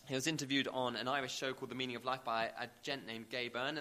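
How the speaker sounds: ordinary talk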